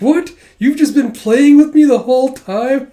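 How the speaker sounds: high and loud, indignant disbelief; shocked outburst. Retold or imitated. Becky realising Lloyd toyed with her, loud shocked cry. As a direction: cry out as if betrayed.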